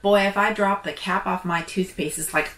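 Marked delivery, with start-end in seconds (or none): none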